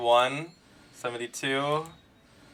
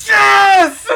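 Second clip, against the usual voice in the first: screaming with joy; full-throated victory yell; ecstatic shout; 14 dB louder than usual. Real, not acted. Wins character on pull.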